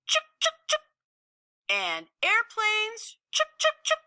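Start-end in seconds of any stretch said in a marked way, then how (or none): 0.1-0.8 high chattering squirrel scold; sharp high squirrel chuks. Acted. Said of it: "She scolded kites". 3.3-4.0 high chattering squirrel scold; sharp high squirrel chuks. Acted. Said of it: "She scolded kites".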